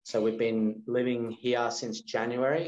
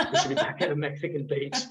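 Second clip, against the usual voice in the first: laughing; 5 semitones higher than usual. Real, not acted.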